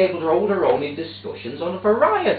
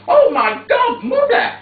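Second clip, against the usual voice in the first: loud, much higher panicked cry; alarmed cry; 9 dB louder than usual. Retold or imitated. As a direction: cry out in shock as she falls. Mother falls on pavement.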